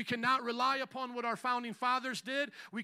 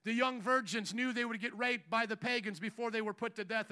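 ordinary delivery

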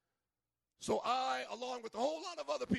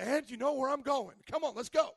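eager, louder call to come along; rally the disciples, pleased to lead; excited Jesus; 5 semitones higher, 8 dB louder than usual. Retold or imitated. Jesus urging disciples to follow, excited. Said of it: "Jesus is all excited".

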